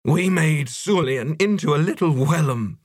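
high, wavering choked protest. A narrator's reading. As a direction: choke it out in distress. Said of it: "choked Idless".

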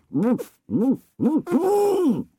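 high mock dog woofs through a full mouth; spluttered woof. A narrator's reading. Blacksmith mocks the knight with a spluttered woof. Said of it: "had a voice and spluttered".

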